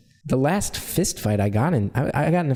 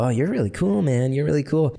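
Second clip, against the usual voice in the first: mock-admiring taunt, pitched up; mocking bully; 5 semitones higher than usual. Bully mimicking the kid with glasses from behind, mocking. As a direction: imitate a bully's sarcastic needling. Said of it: "he always used to mimic".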